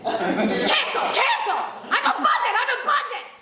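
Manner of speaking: cancel the thought in a hammed-up panic; frantic mock affirmation. Comic mocking new-thought people, frantic high affirmations. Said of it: "new thought people will do this".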